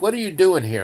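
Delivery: tough biker queen demand. Retold or imitated. Biker queen asks again.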